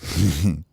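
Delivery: small guilty giggle; sheepish chuckle. Acted. Baby bird caught out by the counselor, sheepish chuckle.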